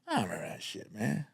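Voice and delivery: quiet lazy brush-off; dismissive mumble. Retold or imitated. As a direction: shrug it off under your breath. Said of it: "looking at you like this".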